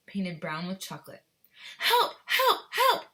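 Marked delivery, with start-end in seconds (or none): 1.8-3.1 very high, loud cries for help; yell. Acted. Boy yelling for help, high and loud. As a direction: cry out from the chocolate. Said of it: "he yelled".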